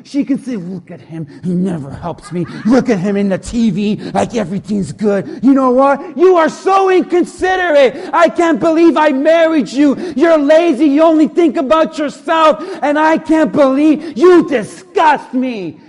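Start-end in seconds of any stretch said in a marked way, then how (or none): 0.6-5.4 low, resentful grumble; grumbling. Acted. Wife griping about husband on the TV, grumbling. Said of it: "could also turn to grumbling". 5.4-15.8 loud, high-pitched spousal tirade; angry grumbling. Acted. Wife berating her lazy husband, angry rant. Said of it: "could also turn to grumbling".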